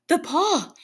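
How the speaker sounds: high, loud, frantic cry; sudden wild outburst. A narrator's reading. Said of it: "she cried wildly".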